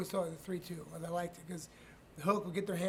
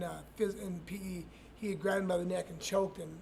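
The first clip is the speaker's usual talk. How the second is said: usual speaking voice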